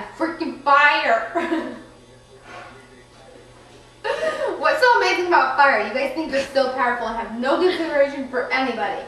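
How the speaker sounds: angry. Acted. Angry flower rants about fire.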